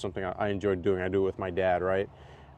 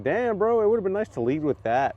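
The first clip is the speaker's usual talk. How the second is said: exasperated retort; 9 semitones higher than usual. Retold or imitated. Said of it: "And I was like".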